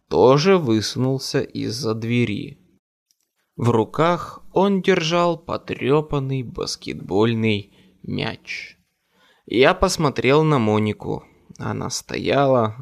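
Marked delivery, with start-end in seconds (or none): none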